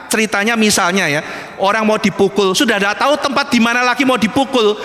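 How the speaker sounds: ordinary talk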